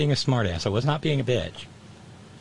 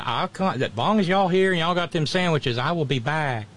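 put-on strong Southern accent; 3 semitones higher than usual. Visitor promising to return for sandwiches, thick Southern accent. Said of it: "the accent was back with a vengeance".

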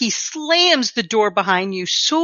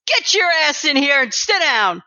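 raised-pitch, angry command; angry order; 6 semitones higher than usual. Acted. Game master as a furious boss ordering an agent in, angry. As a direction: order him in angrily, voice pushed up. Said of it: "He looks incredibly angry".

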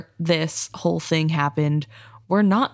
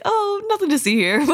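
high, laughing mock nonchalance; mock dismissive; 12 semitones higher than usual. Podcaster mocking characters ignoring the ship, airy laughing brush-off.

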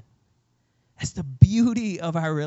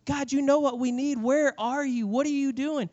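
high-pitched exasperated pleading; frustrated plea; 9 semitones higher than usual. Acted. Person questioning God about what they need, frustrated plea. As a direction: cry out in mild exasperation, pitched up.

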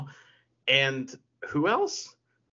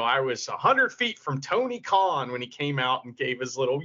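normal talking voice

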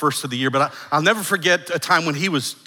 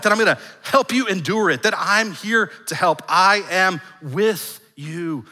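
fervent preaching; 5 semitones higher than usual. Acted. Preaching at rising force.

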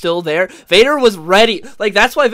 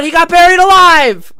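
high, loud disbelief; exasperated shout; 12 semitones higher, 11 dB louder than usual. Real, not acted. Heated argument outburst.

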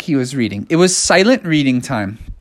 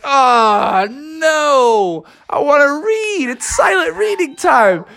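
whiny Froggy; 10 semitones higher, slower than usual. Retold or imitated. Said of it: "And Froggy's like".

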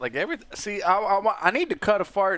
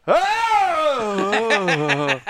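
put-on old man scream; 4 semitones higher, 5 dB louder than usual. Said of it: "screaming like an old man".

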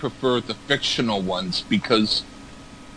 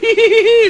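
squeaky laugh; 7 dB louder than usual. Real, not acted. Laughing after puppet voice.

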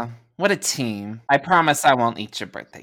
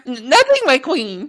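high, stuttering fearful squeak; stammering henchman; 12 semitones higher, 4 dB louder than usual. Acted. Henchman caught cursing, squeaking a scared denial. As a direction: cower and backpedal.

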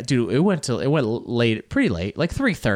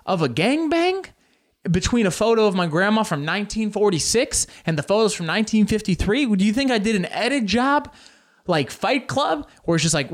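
much higher, indignant disbelief; a comedian fuming at the event planner; incredulous rant; 7 semitones higher than usual. Real, not acted. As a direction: rant at how absurd it is.

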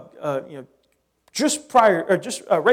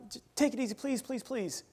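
higher, much softer pleading; begging; 5 semitones higher, 16 dB quieter than usual. Retold or imitated. Moses begging God for mercy, softly pleading. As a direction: coax an angry God to calm down. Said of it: "And he begs for mercy".

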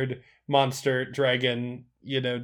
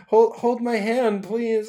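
slow, higher, louder mock pleading; mock date plea; 7 semitones higher, 4 dB louder, slower than usual. Joking as the date of a metal-armed man, mock plea.

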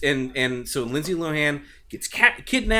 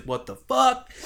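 shocked; 10 semitones higher, slower than usual. Retold or imitated. Character wakes to a missing leg.

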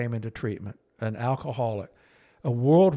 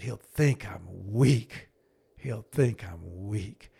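fearful refusal; 3 semitones lower, much slower than usual. Retold or imitated. Scared old man refusing.

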